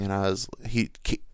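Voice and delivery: ordinary talk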